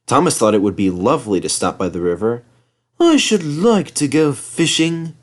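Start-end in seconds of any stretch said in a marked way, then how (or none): 3.0-5.1 higher, slow wistful voice; longingly. Acted. Thomas tells himself his wish, slow and longing. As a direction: say it dreamily to yourself. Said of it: "he said to himself longingly".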